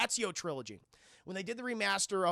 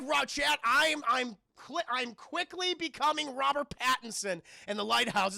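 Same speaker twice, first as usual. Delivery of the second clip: loud yelling; 6 semitones higher, 4 dB louder than usual. Retold or imitated. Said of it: "I'm like".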